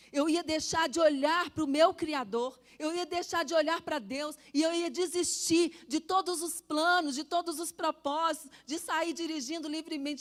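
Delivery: preaching at full force. Real, not acted. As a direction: build it like a pulpit cry. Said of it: "preaching crescendo". (pregando com força)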